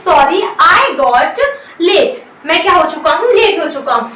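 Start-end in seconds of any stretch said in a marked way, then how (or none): 0.1-2.2 very slow, word by word dictation; slow model sentence. Shown as a demonstration. Teacher dictates the English sentence very slowly. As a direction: spell it out slowly for the class. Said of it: "इसे English में बोलेंगे".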